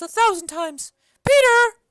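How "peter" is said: loud call up high to a servant. Acted. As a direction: shout his name across the room. Nurse calls her servant.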